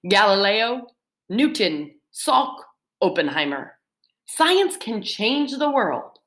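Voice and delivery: ordinary delivery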